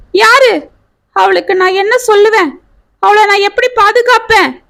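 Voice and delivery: high-pitched, panicked questions rising in despair; distraught wailing. A narrator's reading. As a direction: cry out as if your world just collapsed. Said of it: "என்று அலறிக்கொண்டே".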